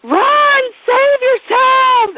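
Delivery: high, loud drawn-out cry of alarm; imagined panic scream. Retold or imitated. Warning everybody of Michael Myers, panicked yell. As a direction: wail it out like a disaster-movie warning.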